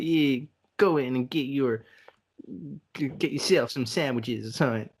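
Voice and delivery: a lightly voiced croaky Australian old man. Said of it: "That was a fantastic accent".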